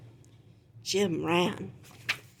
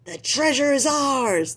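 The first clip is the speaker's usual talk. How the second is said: higher, joyful cheer; gleeful shout; 6 semitones higher, 3 dB louder than usual. Acted. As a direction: crow over the treasure. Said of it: "shouted with glee".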